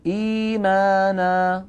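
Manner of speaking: إيمانا recited with the madd held long and slow; drawn-out madd recitation. Shown as a demonstration. Teacher reciting Quran example, stretching the madd. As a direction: chant إيمانا with two-beat madd stretched. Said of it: "الياء قبلها همزة مكسورة فتبد".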